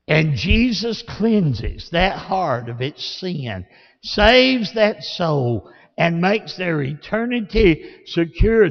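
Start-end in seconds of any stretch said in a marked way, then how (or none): none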